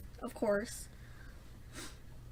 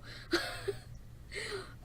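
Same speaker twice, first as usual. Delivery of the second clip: pained high laugh; 10 semitones higher, 8 dB quieter than usual. Real, not acted. Laughs about bleeding.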